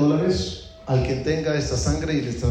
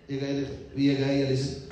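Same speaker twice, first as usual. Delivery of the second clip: ordinary delivery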